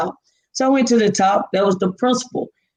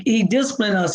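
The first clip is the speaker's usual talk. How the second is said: usual speaking voice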